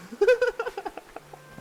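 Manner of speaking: squeaky giggle; high-pitched laugh. Real, not acted. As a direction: laugh high at your own silliness. Laughing at own bad accent.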